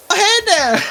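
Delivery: bright high Minnesota "Oh, hey there!"; chirpy Midwestern hello, Minnesota style; mock Minnesota mom greeting; Minnesotan accent. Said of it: "with a very strong Minnesotan accent".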